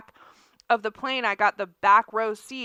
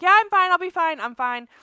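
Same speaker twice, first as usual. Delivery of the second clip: high, quick brush-off, settling as it goes; retold protest of being fine; insistent reassurance; 6 semitones higher, 4 dB louder than usual. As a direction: wave off a friend's concern, starting high and loud. Retold brushing off friend's concern.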